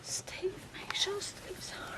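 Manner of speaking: faint whisper from the stretcher; weak whisper. Acted. Mother being carried out, whispering about her son. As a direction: breathe out a worried plea.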